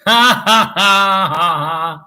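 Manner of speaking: loud, high belly laugh; hearty laugh. Real, not acted. Streamer reacting to own silly clip, loud laugh. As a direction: burst out laughing loudly.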